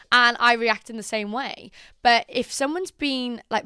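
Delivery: ordinary talk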